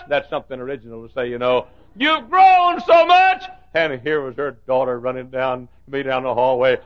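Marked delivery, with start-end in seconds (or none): 2.0-3.4 high, loud, slow exclamation; delighted grandma. Retold or imitated. Grandma greeting grandchildren at the airport, slow delighted exclamation. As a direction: gush over how big they are.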